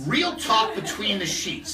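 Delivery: playfully hammed-up title plug; put-on show-title voice. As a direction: draw out the podcast name in a put-on voice. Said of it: "Where's that voice coming from".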